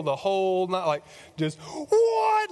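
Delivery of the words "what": very high, loud shriek of disbelief; girl squeal. Acted. Mary freaking out on seeing Jesus, squealing. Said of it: "like, girl squeal".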